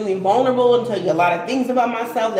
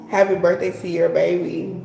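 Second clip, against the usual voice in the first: usual speaking voice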